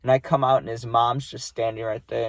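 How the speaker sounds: ordinary talk, nothing marked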